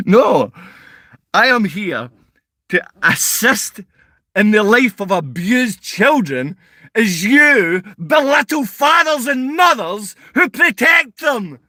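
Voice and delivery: high, loud, slow shouting; shouting down a troll who mocks parents; angry shout. Real, not acted. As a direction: bellow each word at him.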